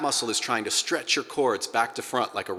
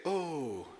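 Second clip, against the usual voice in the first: higher, soft, evenly balanced oh; 6 semitones higher, 9 dB quieter than usual. Shown as a demonstration. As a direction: let out a gentle, balanced oh. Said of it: "if we go for balance".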